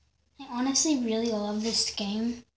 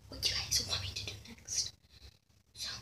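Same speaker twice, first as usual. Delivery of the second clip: whispering; 11 dB quieter than usual. Real, not acted. Whispered outro request to viewers.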